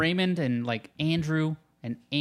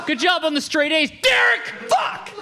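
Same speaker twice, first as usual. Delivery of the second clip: furious praise; 10 semitones higher, slower than usual. Pretend. Dad furiously yelling praise at son Derek. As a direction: yell the compliment like a tantrum. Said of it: "even if you were doing something good".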